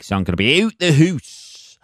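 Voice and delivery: "out the hoose" with a long oo vowel, in a mock local twang; a jokey regional "out the hoose"; as they say. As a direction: drop in "hoose" for house, rhymed with goose. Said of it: "as they say".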